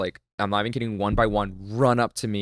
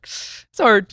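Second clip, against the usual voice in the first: it's hard wailed much higher and louder; high mock lament; 12 semitones higher, 5 dB louder than usual. Shown as a demonstration. Echoing the host, wailing it's hard in a high mock voice.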